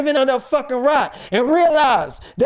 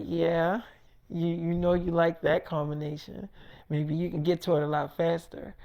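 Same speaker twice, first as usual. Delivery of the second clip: much softer, low flirty murmur; 8 semitones lower, 11 dB quieter than usual. Retold or imitated. Erica to David on the phone, soft flirty murmur. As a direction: coo it down the phone.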